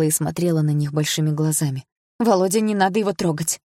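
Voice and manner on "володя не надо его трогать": usual speaking voice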